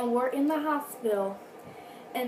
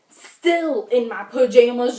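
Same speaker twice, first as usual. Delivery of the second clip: louder, slowed, stressing still in pajamas; loud emphatic; 11 dB louder than usual. Real, not acted. Emphasizing embarrassing outfit.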